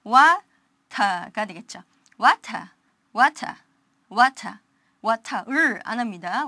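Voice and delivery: water ending in a plain ah with no R, wata; water with a clear T and the final R dropped; water, no curled R at the end; British non-rhotic. Shown as a demonstration. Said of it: "여기에 적용한다면".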